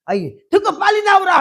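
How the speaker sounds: impatient wife's call, loud and high; retold yell. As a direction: shout for him to come out quickly. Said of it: "그 소리 좀 작게 하래".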